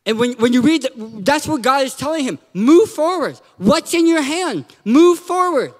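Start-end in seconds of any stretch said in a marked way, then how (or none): 2.5-5.7 higher-pitched, punched orders; insistent command. Retold or imitated. God tells Moses to act, insistent command. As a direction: press each order home as a separate blow.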